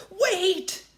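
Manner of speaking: high, loud warning cry; shout. Acted. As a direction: yell to stop her. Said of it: "cried Jack".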